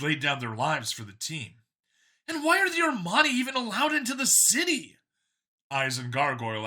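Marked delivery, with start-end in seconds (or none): 2.3-5.0 higher, louder, keen voice; a cheerful zealot pitched up; android copy, eager. A narrator's reading. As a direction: sell it like a proud believer. Copy insults species.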